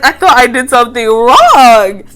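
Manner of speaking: laugh-loud telling the audience; loud amused outburst; excited disbelief. Real, not acted. Recalling shock, laughing.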